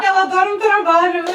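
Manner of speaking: high and loud, laughing through the words; giggling teasing jab; laughing tease. Real, not acted. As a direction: poke fun at her fondly while laughing. Laughing tease about a colleague.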